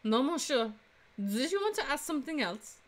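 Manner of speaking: put-on Frenchwoman's French accent; female French accent. Flower seller answering a defense attorney, female French accent. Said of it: "do another female French accent".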